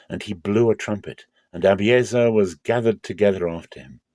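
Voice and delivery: usual speaking voice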